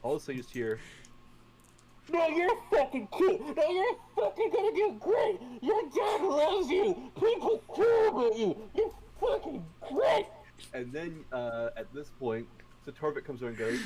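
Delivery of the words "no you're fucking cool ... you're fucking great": very high, strained hammed-up yelling; screamed pep talk. DM imitating James screaming at his reflection, frantic yell. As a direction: bellow compliments in a mock frenzy.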